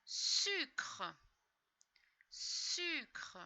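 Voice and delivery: sucre said slowly and clearly; slow word. Shown as a demonstration. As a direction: stretch out sucre. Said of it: "word given slowly".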